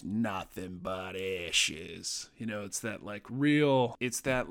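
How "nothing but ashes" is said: slow, low orc in working-class British; low-class British accent. Retold or imitated. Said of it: "perceived low-class British accent".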